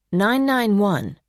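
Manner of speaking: normal talking voice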